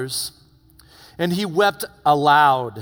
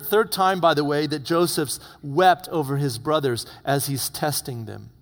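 ordinary talk

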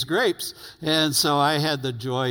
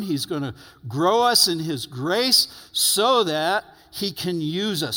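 louder, fervent preacher's lift; excited preaching; 3 semitones higher, 3 dB louder than usual. Acted. Pastor exciting the congregation about greater works, louder. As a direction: rouse them with the promise.

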